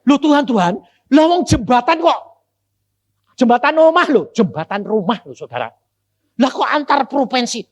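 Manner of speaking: high, loud amazed outburst; astonished. Retold or imitated. Preacher reliving his amazement at heaven's bridge, loud and high. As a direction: blurt it out in disbelief.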